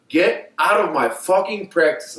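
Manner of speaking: high, slow, emphatic dismissal; retold angry order. Coach kicking player out of practice, retold angry order. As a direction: imitate a coach throwing a player out.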